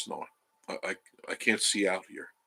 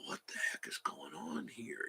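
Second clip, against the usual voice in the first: whispered to himself; 5 semitones lower, 15 dB quieter than usual. Retold or imitated. Retold puzzled thought.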